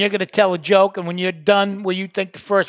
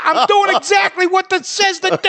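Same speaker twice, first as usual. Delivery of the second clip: much higher, bewildered dad fumbling with the phone; confused old dad; 11 semitones higher, 4 dB louder than usual. Retold or imitated. Dad recording his voicemail, baffled and much higher. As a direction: play the lost father on the voicemail. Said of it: "you would hear".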